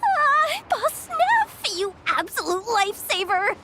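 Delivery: relieved delight. Acted. Relieved greeting of rescuing boss.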